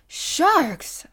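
high startled cry of alarm; startled exclamation. Acted. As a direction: blurt it out in sudden fright. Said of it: "I exclaimed".

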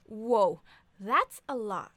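loud impressed whoa; a loud gasp of admiration. Acted. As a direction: blurt it out, amazed. Impressed by sale money.

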